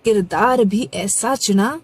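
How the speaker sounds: measured, dramatic recital of a Hindi poem; poem recitation. Acted. Reciting a poem about Ram to viewers, dramatic recital. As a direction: let each line land, pausing between phrases.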